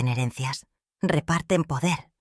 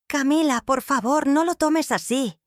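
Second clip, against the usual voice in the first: pleading; 9 semitones higher than usual. Acted. Pleading son after upset wife.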